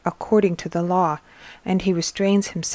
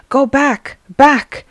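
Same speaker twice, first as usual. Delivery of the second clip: raised, louder warning cry; shouting; 6 semitones higher, 7 dB louder, slower than usual. A narrator's reading. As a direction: shout them back in alarm. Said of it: "he shouts".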